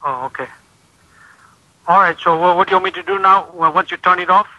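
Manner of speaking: ordinary talk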